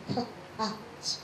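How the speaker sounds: k, at with a gap after the k; cat pulled apart into a k and a soft at; split sounds. Shown as a demonstration. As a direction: softly sound out k, then at. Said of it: "there is a split there".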